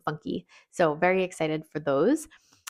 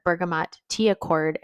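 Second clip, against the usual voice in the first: ordinary talk, nothing marked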